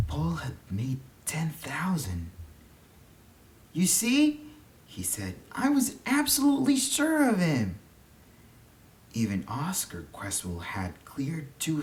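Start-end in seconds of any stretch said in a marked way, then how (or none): 3.7-4.4 put-on high, eager young boy; lightly voiced child, keen to explain; a boy's bright voice. A narrator's reading. Boy Paul speaking to uncle. 5.5-7.8 put-on high, eager young boy; lightly voiced child, keen to explain; a boy's bright voice. A narrator's reading. Boy boasting of his win.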